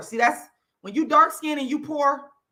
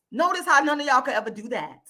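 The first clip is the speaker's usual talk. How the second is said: ordinary talk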